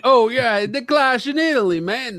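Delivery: mock New York accent, high and loud; hammed-up New York wiseguy. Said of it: "That, that would be a New York accent".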